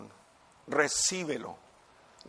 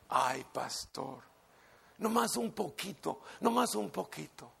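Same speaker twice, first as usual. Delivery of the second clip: soft begging; 3 semitones higher, 4 dB quieter than usual. Retold or imitated. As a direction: coax softly, almost whining. Pastor begs, echoing the doctor. (súplica suave y lastimera)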